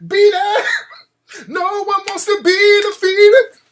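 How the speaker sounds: high, loud pop-star squeal. Retold or imitated. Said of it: "[name] was gonna ... be like".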